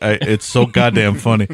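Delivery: ordinary talk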